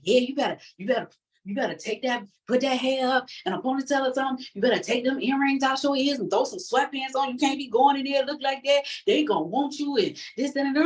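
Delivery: a hint of a scolding father laying down the rules; father's warning. Dad tells his daughter how to dress for court, mildly stern.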